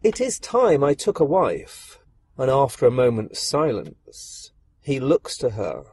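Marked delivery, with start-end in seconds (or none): 0.0-2.0 higher-pitched, musing emperor; kindly emperor. A narrator's reading. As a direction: muse on it with a long hum. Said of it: "looks kindly upon her and says".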